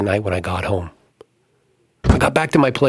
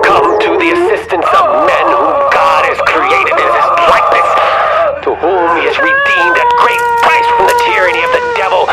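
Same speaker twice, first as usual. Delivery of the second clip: preaching exorcist; 9 dB louder than usual. Acted. Priest on the tape preaching the exorcism prayer at full force. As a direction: thunder the prayer against the demon. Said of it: "A man preached".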